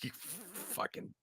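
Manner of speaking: very soft, voiceless mutter; speechless mock exasperation. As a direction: let it escape as an exasperated whisper.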